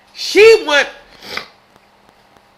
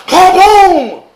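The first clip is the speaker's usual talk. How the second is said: laughing kaboom; 7 semitones higher, 7 dB louder than usual. Real, not acted. Laughing at the death.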